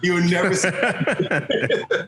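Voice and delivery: laughing at the lost recording. Real, not acted. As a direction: drag it out through a laugh. Laughing slow drawn.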